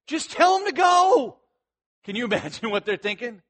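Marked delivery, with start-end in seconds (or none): 0.1-1.4 high, loud, drawn-out command; fed-up order to get moving; impatient yell. Acted. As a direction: shout it in exasperation. Acting out God's impatient order.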